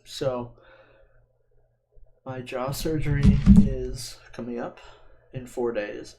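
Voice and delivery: ordinary talk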